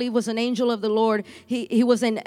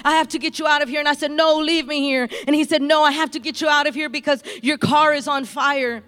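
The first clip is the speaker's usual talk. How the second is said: rising, louder, higher preacher's call building to a shout; preaching at full force; 5 semitones higher, 6 dB louder than usual. Real, not acted. Pastor urging the congregation to come forward, building to a shout. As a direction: preach it out louder and higher, pulling them to the altar.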